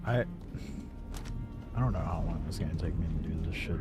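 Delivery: ordinary talk, nothing marked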